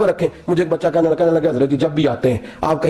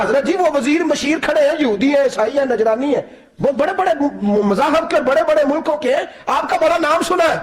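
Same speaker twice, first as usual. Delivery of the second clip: anxious pleading; 8 semitones higher than usual. Acted. Servant pleads, pointing at envoys.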